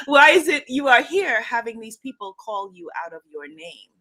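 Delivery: put-on emphatic Igbo-accented reproach; bad Igbo teacher accent. Igbo teacher reproaching a student, badly imitated accent. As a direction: scold gently in a roughly imitated Igbo accent. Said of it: "for doing a very bad accent".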